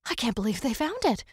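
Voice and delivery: excited, can't-believe-it tone; excited disbelief. Acted. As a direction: marvel at the news. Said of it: "He was excited".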